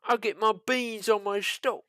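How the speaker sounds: a Yank's jokey British voice; American British accent. Pretend. Host teasing British love of beans, mock British accent. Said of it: "American British".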